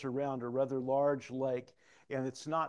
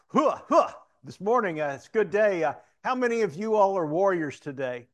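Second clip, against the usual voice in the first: loud, high-pitched mock war cry; imitated hua rallying cry; 6 semitones higher, 12 dB louder than usual. Rallying a roomful at a sheriffs' meeting, loud hua. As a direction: bellow a greeting to rouse the room. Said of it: "he does this hua thing".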